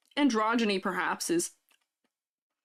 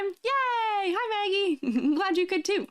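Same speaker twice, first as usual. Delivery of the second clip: excited; 10 semitones higher, slower than usual. Real, not acted. Greeting a viewer happily.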